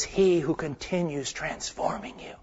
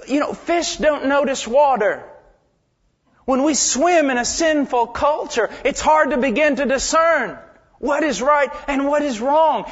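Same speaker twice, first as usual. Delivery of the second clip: raised, driving preacher's voice; full-force preaching; 8 semitones higher, 5 dB louder than usual. Acted. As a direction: preach it out to the whole church. Preaching to the congregation.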